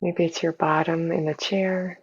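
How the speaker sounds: soft, unhurried meditation voice with long pauses; hushed, even guidance for settling into the body; guided meditation. Real, not acted. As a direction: gently guide listeners inward, leaving space between phrases.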